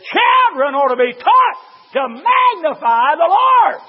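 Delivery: high, slow, drawn-out shouted quote; preaching shout. Retold or imitated. Preacher to congregation, quoting Spurgeon in a huge slow shout. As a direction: roar every word out to the rafters. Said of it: "I'm telling you what a great man of God said".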